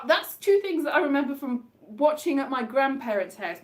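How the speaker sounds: usual speaking voice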